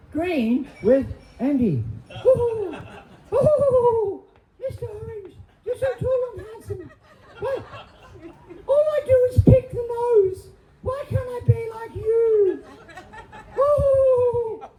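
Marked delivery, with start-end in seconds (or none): 2.2-7.6 high, excited puppet voice; squeaky finger character. Acted. 8.7-14.7 high, whiny puppet voice; squeaky finger character. Acted.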